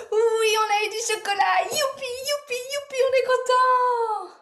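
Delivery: high, loud hammed-up cheering; gleeful sing-out hooray, stretched at the end; pretend happiness. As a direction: play overjoyed like an excited child. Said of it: "on va tous faire en qu'on est content".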